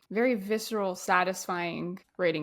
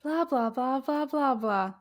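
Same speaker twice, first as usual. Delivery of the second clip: droning lecturer. Retold or imitated. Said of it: "that TikTok that's like".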